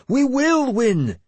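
very high, loud, stretched-out cry; rally the ranks with a slow, full shout. Acted. Leader assures his men of victory, shouting. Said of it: "Sigtryggur shouted".

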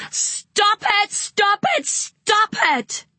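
put-on mother snapping and shrieking at her child; screaming like a mad woman. A narrator's reading. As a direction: lose all control and shriek it. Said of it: "she screamed like a mad woman".

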